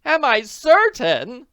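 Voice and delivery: high, loud, slow outraged cry; hammed-up indignant scream; mock scream. A narrator's reading. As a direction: shriek it back in disbelief. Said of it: "Missus Willoughby screamed".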